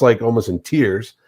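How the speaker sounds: usual speaking voice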